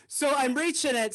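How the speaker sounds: normal talking voice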